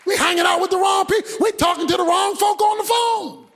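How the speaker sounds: full-voiced sermon climax; preaching at full force.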